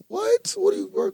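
high, stunned disbelief; can't believe what he heard; incredulous. Retold or imitated. Disbelief at friend's excuse.

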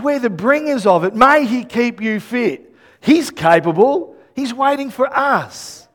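normal talking voice

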